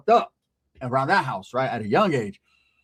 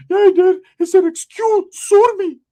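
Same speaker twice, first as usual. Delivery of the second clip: put-on high, loud whimpering teen voice; crying cousin voice; 14 semitones higher, 10 dB louder, slower than usual. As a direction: wail it like a scared kid cousin. Cousin Ricky arguing back.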